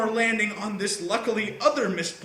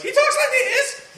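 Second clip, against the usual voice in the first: high, loud martial-arts squeal; Bruce Lee fighting voice; 7 dB louder than usual. Retold or imitated. Imitating Bruce Lee fighting, to podcast friends. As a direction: squeal it like a kung fu fight cry. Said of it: "only if he sounds like Bruce Lee".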